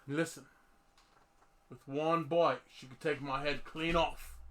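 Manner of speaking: low-pitched Australian wildlife-guide voice; Australian accent. Pretend. Host plays wildlife guide near angry producer, Australian accent. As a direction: put on an Australian accent, warning of a dangerous creature. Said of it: "Can you talk with an Australian accent?".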